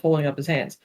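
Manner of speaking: plain reading voice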